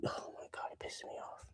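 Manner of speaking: hushed, exasperated whisper; frustrated whisper. Real, not acted. Streamer venting about his hair in an annoyed whisper. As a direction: breathe it out through frustration.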